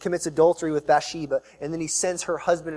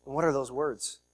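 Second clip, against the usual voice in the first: usual speaking voice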